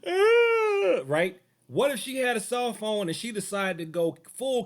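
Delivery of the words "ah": high shrill mock scream; woman's imagined scream, high and loud. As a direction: let out a hammed-up scream. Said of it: "she's screaming".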